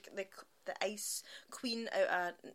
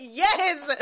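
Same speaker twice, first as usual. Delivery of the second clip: loud, high delighted "yes"; retold cheer; 6 semitones higher, 12 dB louder than usual. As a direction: cheer it out loudly, replaying your reaction. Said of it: "I was like".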